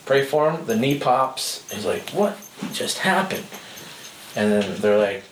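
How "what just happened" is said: stunned disbelief. Retold or imitated. Guy stunned after knee pops.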